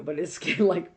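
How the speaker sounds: ordinary talk, nothing marked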